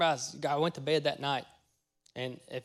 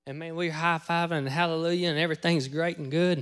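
ordinary delivery

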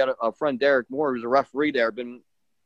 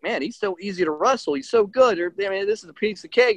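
excited; 6 semitones higher than usual. Retold or imitated. Imagining an easy match on the plane.

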